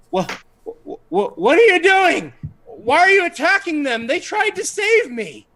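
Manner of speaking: high, loud, stammering creature voice; flustered Gizik. Acted. Gizik confronting the party over the attack, high panicked stammer. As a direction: stutter it out, squeaky and bewildered. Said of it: "and he goes".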